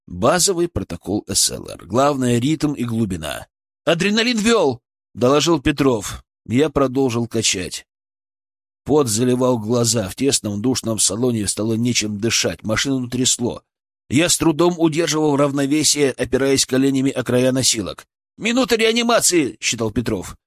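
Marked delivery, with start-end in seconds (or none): 3.8-4.8 urgent report. A narrator's reading. Medic reports drug during emergency. 18.4-19.6 high, urgent announcement; calling out time. A narrator's reading. Colleague calls time during resuscitation, announcing urgently.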